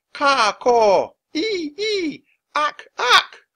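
very high far-off monkey and bird calls; wild forest screeching. Acted. Forest animals greeting the treehouse, wild screeching. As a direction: screech and caw like jungle creatures. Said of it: "wild screeching and cawing".

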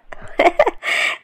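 high, airy giggle; teasing laugh. Acted. Shahd giggling at Kamal's complaint, amused.

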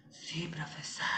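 soft, hollow ghostly mock voice; imitating a voice from beyond the grave. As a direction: echo the student like a ghost. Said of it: "Parecía voz de ultratumba". (imitando una voz de ultratumba, suave)

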